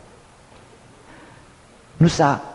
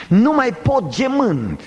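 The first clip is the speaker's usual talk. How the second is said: high, drawn-out cry from the pulpit; declaimed lament; 10 semitones higher, slower than usual. Acted. Preacher voicing David's psalm, declaimed lament. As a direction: stretch it out in a high plea. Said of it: "El spune așa".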